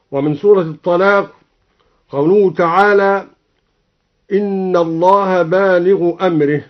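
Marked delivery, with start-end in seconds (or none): none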